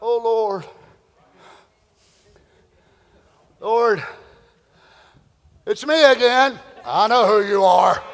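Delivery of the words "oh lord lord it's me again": timid, hesitant approach to God; sheepish. Retold or imitated. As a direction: shuffle in like a guilty child.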